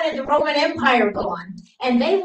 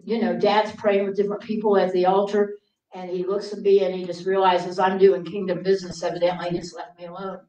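ordinary talk, nothing marked

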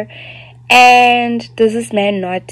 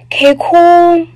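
drawn-out, much higher sarcastic okay cool; 11 semitones higher, 8 dB louder, slower than usual. Real, not acted. Telling viewers the story's grim turn, slow high sarcasm. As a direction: stretch it out slowly, dripping sarcasm. Ironic wrap-up after the story.

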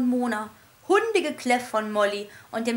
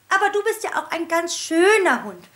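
cooing teacher; 6 semitones higher, 5 dB louder than usual. A narrator's reading. Teacher voice praising the dog.

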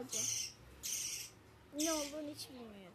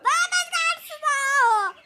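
loud, much higher call; shouting; 10 semitones higher, 16 dB louder than usual. Real, not acted. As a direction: shout out the warning. Calling out another thief.

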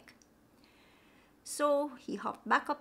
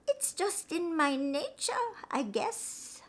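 higher and slow, fading to a whisper on I guess; a lazy shrug of a snake, trailing off; sly snake; 8 semitones higher, slower than usual. Acted. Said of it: "made a little hiss and said".

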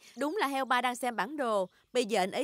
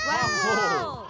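high, loud gasp of wonder; big awestruck wow; amazed wow; 5 dB louder than usual. Acted. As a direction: marvel at the castle. Reaching Windy Castle.

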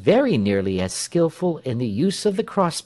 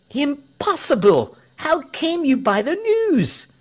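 very high, astonished voice; incredulous outcry; 13 semitones higher, 3 dB louder than usual. A narrator's reading. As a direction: challenge the news in disbelief. Crowd disbelieves the pastor.

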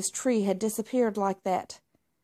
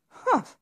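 high, loud scornful humph; indignant humph; 10 semitones higher, 5 dB louder than usual. Acted. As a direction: snort in disgust. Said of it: "Marmalade said".

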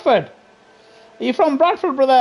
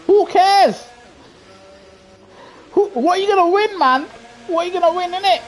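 mocking; 7 semitones higher than usual. Mocking a sore loser.

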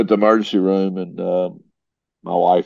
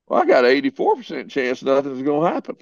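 high, breezy dismissal; cocky brush-off; 6 semitones higher than usual. Retold or imitated. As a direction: shrug off the odds lightly. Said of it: "me, being who I am, said".

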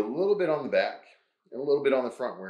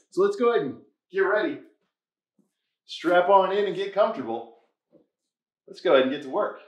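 much higher, louder, quick pep call; rally the viewers to get started; hyped-up kickoff; 9 semitones higher, 6 dB louder than usual. Acted. Host firing up viewers before the work begins, excited.

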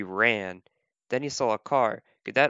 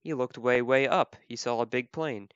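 usual speaking voice

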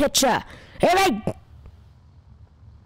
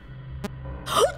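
startled gasp; 10 semitones higher, 6 dB quieter than usual. Real, not acted. Startled by something in the vents.

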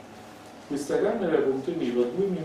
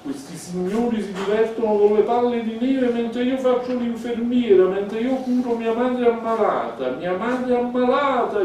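high, loud, self-important shouting; 9 semitones higher, 6 dB louder than usual. Acted. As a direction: shout your grievance for everyone to hear. (grido alto e indignato)

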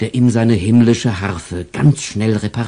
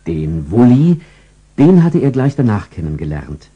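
ordinary delivery

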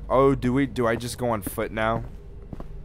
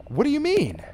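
very high, louder mock disbelief; mock indignant; 3 dB louder than usual. Brother answering a rude stranger, mock indignant. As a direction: squeal it in hammed-up offence. Acts player's indignant reply.

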